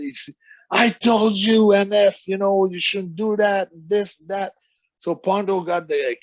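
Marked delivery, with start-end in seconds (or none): 0.7-4.5 high, raised-voice mock telling-off; imitated scolding. Wrestler pretending to know about the injury, mock scolding. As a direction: scold him in a higher, put-on pitch. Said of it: "he pretends like he just knows".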